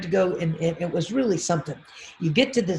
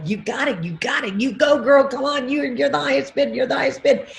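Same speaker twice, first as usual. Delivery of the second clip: cheering crowd; 7 semitones higher, faster than usual. Retold or imitated. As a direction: cheer her on like the whole room. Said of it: "everybody was like".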